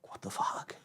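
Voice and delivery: real whispered disbelief; a hushed exasperated whisper to himself. Real, not acted. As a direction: breathe it out, voiceless and stunned. Exasperated aside after failed reset.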